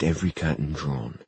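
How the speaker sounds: ordinary delivery, nothing marked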